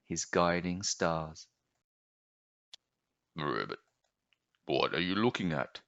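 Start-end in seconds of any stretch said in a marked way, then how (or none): 3.3-5.8 much lower, soft ribbit then croaky frog voice; low frog croak. Acted. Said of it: "croaked a voice".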